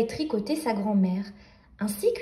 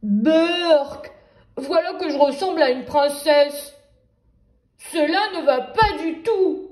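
loud, grossed-out wolf voice; wolf exclaiming in disgust; 3 semitones higher, 4 dB louder than usual. Acted. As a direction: spit out the berk like he smelled something. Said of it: "et s'exclama".

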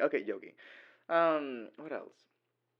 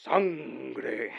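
louder, drawn-out meme exclamation; mock guard cry; 3 semitones higher, 6 dB louder than usual. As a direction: draw it out in mock drama. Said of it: "And he go like".

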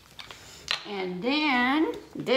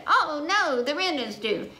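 worried cow; 6 semitones higher, 3 dB louder than usual. Acted. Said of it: "Cow said".

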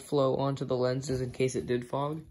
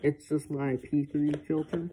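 muffled behind face mask; speaking through gas mask; 2 semitones higher than usual. Real, not acted. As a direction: voice through respirator. Seen 1: full-face gas mask worn throughout, hand screwing filter.

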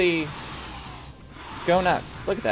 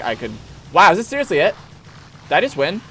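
ordinary talk

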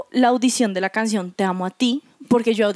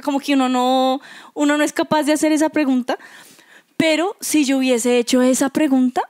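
ordinary delivery

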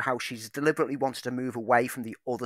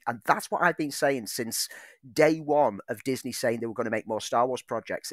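ordinary talk, nothing marked

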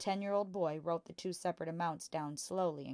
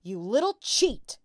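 slow, loud, higher accusing shout; furious shout; 8 semitones higher, 11 dB louder, slower than usual. A narrator's reading. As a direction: roar it at her, pointing. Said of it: "the father suddenly shouted".

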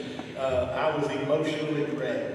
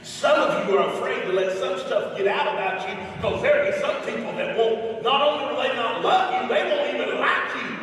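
higher and louder, building as he goes; full-force preaching; 10 semitones higher, 9 dB louder than usual. Real, not acted. Preacher pleading with the congregation, building to a loud climax. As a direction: drive it home to the congregation.

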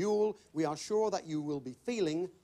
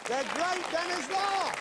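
grand announcement; 13 semitones higher, 4 dB louder, slower than usual. Acted. Host hails guest star grandly.

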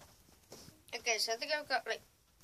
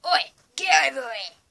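loud yell as a put-on American; hammed-up American, shouted higher and louder; American impression; 4 semitones higher, 16 dB louder than usual. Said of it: "An American".

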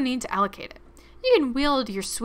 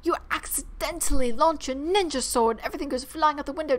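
ordinary delivery

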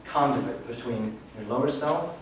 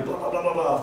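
mock mental chatter, pitched up; monkey chatter; 5 semitones higher than usual. Speaker mimics the intellect's noise to an audience, mock chattering. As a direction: babble it like an overactive mind. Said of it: "you've got the monkey chatter".

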